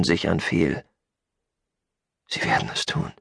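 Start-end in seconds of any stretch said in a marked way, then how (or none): 2.3-3.1 low, soft, almost voiceless; defeated murmur. Acted. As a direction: say it quietly, beaten. Defeated, turns to his companion. (leise, tief und ohnmächtig)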